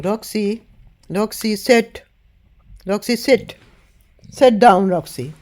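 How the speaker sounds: loud, high commands to the dog; calling the dog. Real, not acted. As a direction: call Roxy firmly to sit down.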